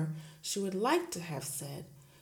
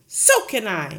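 loud snap; snapped; 4 semitones higher, 15 dB louder than usual. Acted. Headmistress snapping at the teacher, loud. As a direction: snap it back curtly. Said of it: "Miss Trunchbull snapped".

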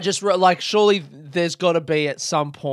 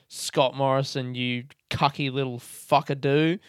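ordinary talk

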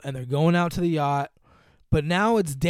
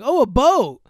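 excited cry; 9 semitones higher, 11 dB louder than usual. Retold or imitated. She spots a boat excitedly.

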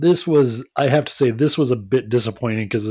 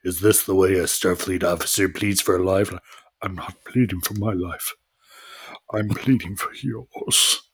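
grave Picard impression; 6 semitones lower, 4 dB quieter than usual. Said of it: "he does the whole".

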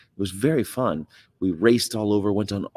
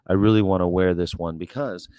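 ordinary delivery

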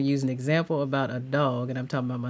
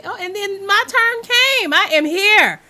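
high, loud burst of delight; gushing, high-pitched thanks; real excitement; 5 dB louder than usual. Real, not acted. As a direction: squeal it out, thrilled to finally be here. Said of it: "I'm so excited".

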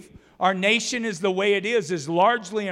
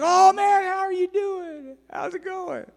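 high, overly sweet mock greeting, much higher; two-faced gushing hello, pitched way up; fake friendly greeting; 12 semitones higher than usual. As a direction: gush with fake delight at a person you were just gossiping about. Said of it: "Honor does not sound like this".

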